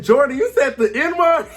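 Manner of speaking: high, loud mock gasp of disbelief; hammed-up shocked retelling; shocked question. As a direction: ask it like you can't believe your ears.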